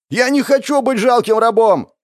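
loud, high strained scream; defiant yell. A narrator's reading. Lanky guy refusing to be a slave, yelling. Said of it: "заорал тот самый длинный парень".

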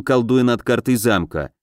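plain reading voice

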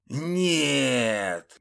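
short begging cry of no; pleading cry. A narrator's reading. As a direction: beg desperately. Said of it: "взмолился упырь".

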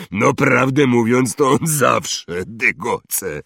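big hearty voice, pleased; hearty Hagrid, triumphant. Acted. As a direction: crow a jovial told-you-so. Hagrid's figure in the story.

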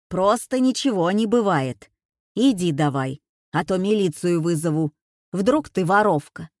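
loud, cranky granny telling someone off; loud threats from a grumpy old woman; scolding old woman. A narrator's reading.

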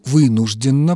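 normal talking voice

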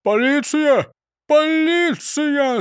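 high, drawn-out alarm call; acted cry for help. A narrator's reading. Крендель calls police over the stolen parrot, crying out. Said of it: "закричал Крендель".

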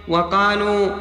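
ordinary delivery

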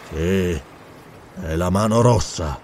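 low, subdued reply; low, ominous character voice; grim answer. Acted. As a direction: confirm it darkly, dropped deep. Dark answer to Zarono's question.

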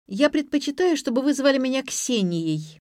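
high-pitched, testy correction; snappish. A narrator's reading. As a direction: snap back at your grandmother. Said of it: "огрызнулась старшая внучка".

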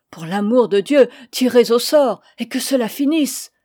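loud frightened plea; old landlady, frantic over her cups; panicked plea. Acted. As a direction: beg them to stop. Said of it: "affolée".

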